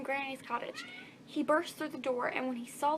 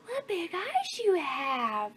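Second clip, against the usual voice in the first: slow wonder; 5 semitones higher, 4 dB louder, much slower than usual. Acted. Girl's wondering line.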